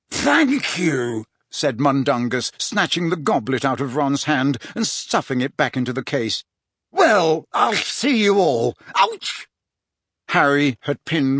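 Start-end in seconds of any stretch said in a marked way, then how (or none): 0.1-1.2 raised, put-on rogue's voice; Mundungus. A narrator's reading. Mundungus snatching the goblet back. 6.9-9.5 drawn-out cheery exit cut off by a high yelp; Mundungus. A narrator's reading. Mundungus leaving, then grabbed by Harry.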